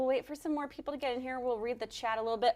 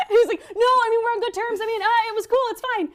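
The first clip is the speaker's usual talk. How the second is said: talking through laughter, loud; laughing; 11 semitones higher, 10 dB louder, faster than usual. Real, not acted. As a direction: laugh while telling it. Laughing while retelling.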